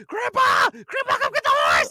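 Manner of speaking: put-on very high, loud panicked child's scream; screaming for grandpa. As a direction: scream for help like a terrified kid. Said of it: "and I'm screaming".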